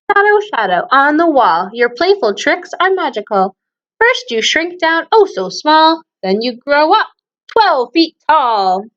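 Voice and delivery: teasing storytime rhyme; poem read to kids. Acted. Reads shadow show poem.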